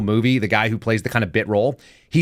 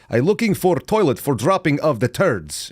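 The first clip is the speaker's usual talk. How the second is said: higher-pitched put-on Eastern European accent; mock Slavic accent; 4 semitones higher than usual. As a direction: do a comic broken-English Slavic accent. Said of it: "he said".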